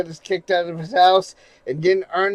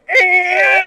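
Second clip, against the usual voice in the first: high, loud drawn-out record screech; imitated record scratch; 14 semitones higher, 5 dB louder than usual. Streamer retelling the movie party, high imitated record noise. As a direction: imitate a needle ripped off a record. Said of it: "you hear the record go".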